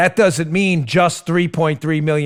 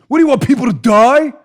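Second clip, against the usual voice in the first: mocking question; 9 semitones higher, 7 dB louder than usual. Mocking opponents' framing.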